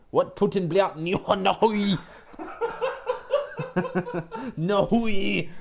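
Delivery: "What Putin bliat nahui" shouted high and slow in a hammed-up Russian accent, cracking into laughter; loud mock Russian. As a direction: belt the fake Russian and crack up. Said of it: "do you can speak Russian?".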